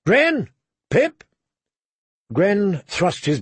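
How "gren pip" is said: high shouted names; sharp, raised call to his men; barked command. A narrator's reading. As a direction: bark each name to summon them. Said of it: "John barked".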